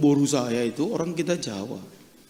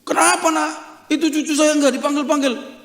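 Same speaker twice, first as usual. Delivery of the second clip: high, loud imitation of an angry elder; acted angry complaint; 11 semitones higher, 5 dB louder than usual. Grandparent storming into the pastor's office, acted anger. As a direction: scold in a raised, pitched-up voice. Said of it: "marah-marah".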